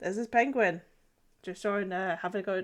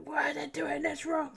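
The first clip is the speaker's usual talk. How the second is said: whispered mock rage, higher; mock raging whisper; 8 semitones higher than usual. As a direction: hiss it out like a fake tantrum. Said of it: "I was like".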